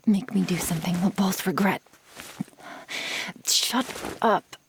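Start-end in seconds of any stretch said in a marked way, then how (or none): 3.5-4.6 sharp, loud, frustrated snap; angry outburst. Acted. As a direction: lose your temper all at once. Lover snaps during a struggle.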